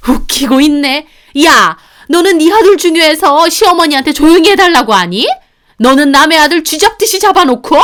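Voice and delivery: high, loud mocking rage; mother-in-law yelling down her daughter-in-law; scornful shouting. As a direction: sneer then yell her down.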